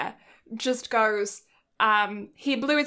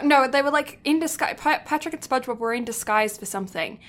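normal talking voice

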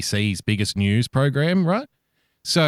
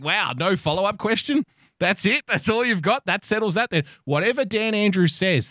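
higher, scoffing disbelief at the journalist; mock him like he's a pushover; incredulous mockery; 7 semitones higher than usual. Mocking a newsreader who asked no follow-up, incredulous. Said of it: "And I'm like".

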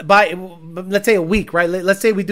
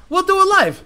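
catchphrase shout; 11 semitones higher, 5 dB louder than usual. Retold or imitated. Quoting catchphrase.

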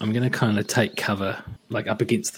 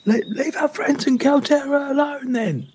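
high, raised character yell; pitched-up yell at a distant foe; yelling from cover. Acted. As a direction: call out from behind a wall. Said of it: "Lovejoy yells from behind the wall".

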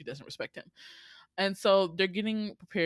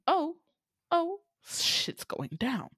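mock gasp; 11 semitones higher, 4 dB louder, slower than usual. Audience reacting to tense look.

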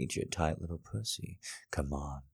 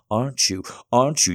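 shouting; 6 semitones higher, 9 dB louder, faster than usual. Acted. Taunting, rising shout.

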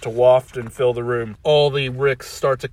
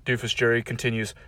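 plain reading voice

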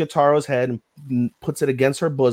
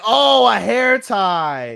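slow relief; 8 semitones higher, 7 dB louder, much slower than usual. Retold or imitated. Relieved realization, drawn out.

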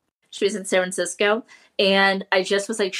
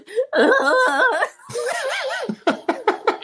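high-pitched pretend sob breaking into laughter; mock blubbering wail. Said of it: "like, blubbering".

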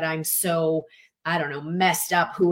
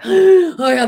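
much higher, louder laughing oh my God; mock overwhelmed exclamation; 11 semitones higher, 13 dB louder than usual. Coach hams up a stressed, busy friend laughing. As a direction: blurt it out in hammed-up overwhelm.

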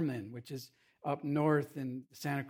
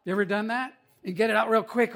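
ordinary delivery

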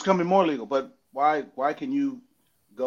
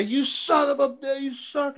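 heckler; 13 semitones higher, slower than usual. Retold or imitated. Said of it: "you're gonna say".

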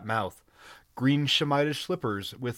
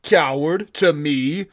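outraged; 4 semitones higher, 9 dB louder, slower than usual. Acted. Giant insulted, outraged reply.

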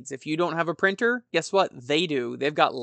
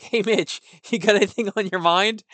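higher, louder cheery hello through a laugh; laughing cheery greeting; 5 semitones higher, 5 dB louder than usual. Retold or imitated. Host retelling his message to Mitch, laughing cheerily. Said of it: "I was like".

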